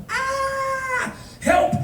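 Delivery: high mock scream for help; mock panic. Stranded tourist reacts to the departed van, mock-panicked scream. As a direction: cry out in comic panic.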